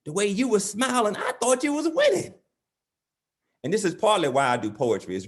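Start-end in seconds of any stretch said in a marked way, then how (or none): 0.0-2.3 acted dad, climbing to a high-pitched punchline; straight-faced dad. Dad answering his beaten son, straight-faced then high-pitched. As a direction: start matter-of-fact, then pitch way up in earnest. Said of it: "with a straight face".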